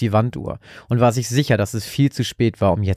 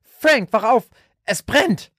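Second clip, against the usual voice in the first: hysterical scream; 12 semitones higher, slower than usual. A narrator's reading. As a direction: scream his name in terror. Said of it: "schrie sie plötzlich hysterisch".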